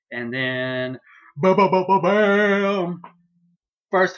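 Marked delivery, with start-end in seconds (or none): 1.4-3.0 loud, high sung-out "bam" fanfare; dramatic reveal sting. Acted. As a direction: punch out the syllables, then hold the last one. Mimics dramatic entrance fanfare.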